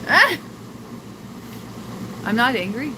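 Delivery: ordinary talk, nothing marked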